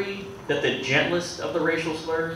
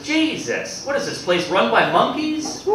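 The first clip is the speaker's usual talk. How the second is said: exasperated snap; 8 semitones higher, slower than usual. Acted. Fed-up customer at a restaurant after botched orders, exasperated. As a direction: act out a customer losing patience. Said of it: "the guy just snaps".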